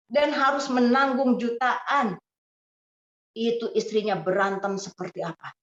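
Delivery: usual speaking voice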